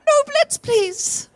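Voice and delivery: very high, strained comic squeal; mock desperate plea. Comedian acting out regretful Blitz voters, mock desperate plea. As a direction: plead in an exaggerated, high-pitched wail.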